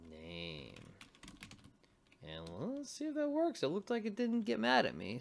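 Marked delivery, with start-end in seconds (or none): 2.6-5.2 thrilled the script didn't throw an error; excited. Real, not acted. Coder to viewers, relieved script runs: excited high burst. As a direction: pitch jumps way up, louder and quicker, relieved the code ran. Code run seems to work.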